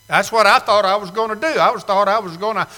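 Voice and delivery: ordinary delivery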